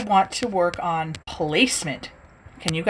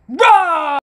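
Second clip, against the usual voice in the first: big playful troll roar, higher; hammed-up monster roar; 9 semitones higher, 6 dB louder than usual. Troll roars.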